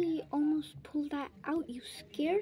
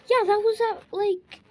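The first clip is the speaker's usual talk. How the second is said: loud, higher-pitched burst of worry; agitated outburst; 7 semitones higher, 12 dB louder than usual. Acted. Sibling fretting over Niall gone on his walk, agitated. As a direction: cut in loudly, pitch up.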